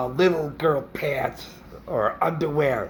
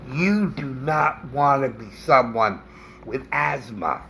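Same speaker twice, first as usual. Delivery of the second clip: ordinary delivery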